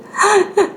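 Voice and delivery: high, heaving sobs in bursts; imitated bursting sobs. Father breaking down on the phone to mother, imitated sobbing. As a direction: mimic held-back crying suddenly exploding. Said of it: "뭔가 참았던 걸 폭파하는 듯이".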